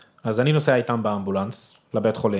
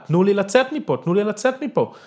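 retold high desperate yell, much higher and louder; tantrum yell; 10 semitones higher, 3 dB louder than usual. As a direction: cry out like a helpless child in a tantrum. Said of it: "כמו ילד, בטנטרום, בהתקף זעם". (צעקה נואשת של ילד בהתקף זעם)

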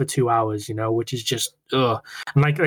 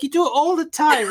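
bickering sister; 13 semitones higher than usual. Retold or imitated.

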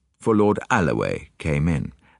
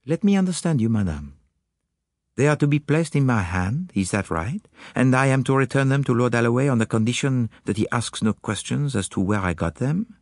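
put-on higher, accented detective voice; Poirot; 7 semitones higher than usual. Detective confiding little ideas to Hastings, higher accented voice. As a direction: play the musing detective, pitched up. Poirot's dialogue in narrated story.

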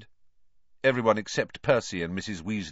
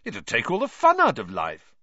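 revolted scoff; 4 semitones higher, 6 dB louder than usual. A narrator's reading. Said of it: "looking revolted".

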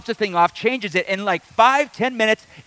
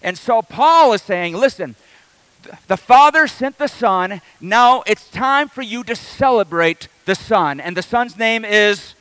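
drawn-out, louder pulpit delivery; slow emphatic preaching; 3 semitones higher, 5 dB louder than usual. Acted. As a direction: lean on every word for the congregation. Preaching the gospel.

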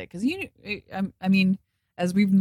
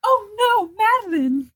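a much higher, hammed-up fake sob on the name; fake crying; 4 dB louder, slower than usual. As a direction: overact tears as if rehearsing before a mirror. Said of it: "try to get herself to look like she's crying".

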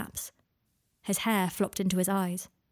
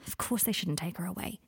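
soft reassurance; 5 dB quieter than usual. Acted. Harry steadies pacing Draco.

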